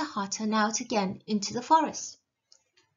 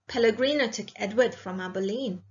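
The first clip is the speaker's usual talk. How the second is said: usual speaking voice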